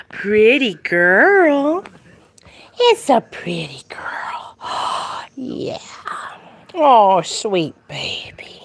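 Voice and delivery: slow, half-whispered cooing. Real, not acted. Owner fussing over her pretty dog, doting baby talk. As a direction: coo adoringly at a pet, stretching every word.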